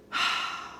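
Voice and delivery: voiceless sigh. Real, not acted. Streamer settling after an emotional reunion, sighing. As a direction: let the breath out after emotion.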